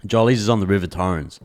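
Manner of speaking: normal talking voice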